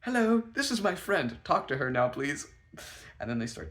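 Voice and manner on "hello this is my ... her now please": high, loud, pushy greeting; frantic introduction. Retold or imitated. Shoving friend at the DJ, acted frantic. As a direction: blurt the introduction with forced urgency.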